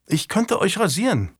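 put-on high-pitched young squire; youthful voice making a polite offer; boy offering help. A narrator's reading. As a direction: pitch it up as an eager-to-serve lad. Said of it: "erbot sich der Junge".

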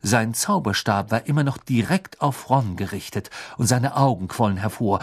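ordinary delivery, nothing marked

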